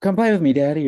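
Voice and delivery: mock whiny kid asking to play; child begging. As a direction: imitate a small child pulling at dad. Kids calling father, imitated.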